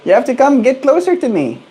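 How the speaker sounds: very high squeaky pet voice; talking to a pet bird. Real, not acted. Calling bird out of cage.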